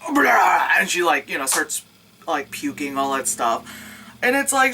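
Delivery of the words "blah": loud mock retching sound; gagging noise. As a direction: fake a sudden heave. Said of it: "suddenly she's like".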